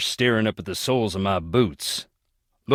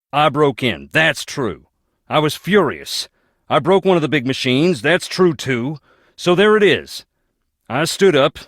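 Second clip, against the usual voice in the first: heated confession; 6 semitones higher, 5 dB louder than usual. Acted. Recounts wrecking the factory machine.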